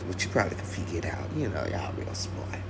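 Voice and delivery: hushed aside to the crowd; conspiratorial whisper to the audience. Real, not acted. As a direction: drop to a whisper, knowing.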